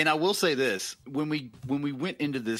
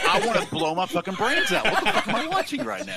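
laughing mock despair; 8 semitones higher, 5 dB louder than usual. Voicing the horrified viewer.

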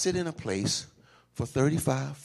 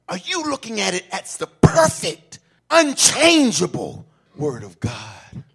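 ordinary talk, nothing marked

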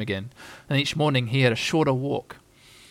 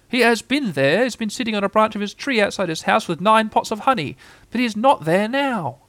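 higher-pitched Owl reporting back; fussy Owl; 7 semitones higher than usual. Acted. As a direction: report insistently as a storybook owl.

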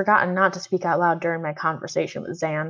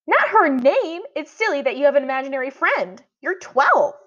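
put-on much higher, scornful little girl; little sister's voice; 7 semitones higher than usual. A narrator's reading. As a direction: tease him like a kid sister. Violet teasing her brother.

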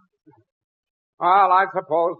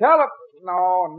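ordinary talk, nothing marked

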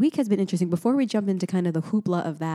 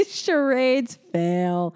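mock announcement; 4 semitones higher, 4 dB louder, much slower than usual. Real, not acted. Joking verdict after miscommunication.

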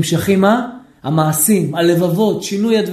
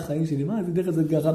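soft, lower, gentle mock sweetness; tender, loving; 6 semitones lower, 9 dB quieter, faster than usual. Husband asks his wife gently, in a soft loving voice. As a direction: say it softly, as if to your darling. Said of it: "הוא לא יכול להגיד לה".

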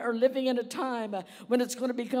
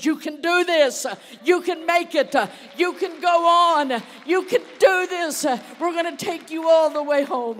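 loud, higher, building to a shout; rousing shout; 6 semitones higher, 10 dB louder than usual. Acted. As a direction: lift the crowd, shout it home. Grace whispering encouragement, preached loud.

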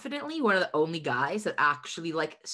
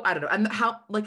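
ordinary delivery

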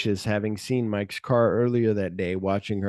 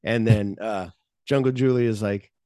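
normal talking voice

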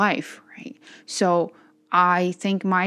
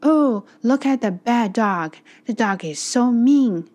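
higher, louder, slow mock of a scolding neighbor; mocking the neighbor woman; 5 semitones higher, 6 dB louder than usual. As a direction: drawl it out like a snooty lady tutting at a dog. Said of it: "that woman said".

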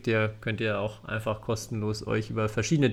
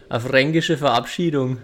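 light Franconian dialect, louder; attempted Franconian lilt; Franconian accent; 6 dB louder than usual. Shown as a demonstration. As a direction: put on a bit of Franconian for a sign-off. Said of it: "auch was auf Fränkisch sagen".

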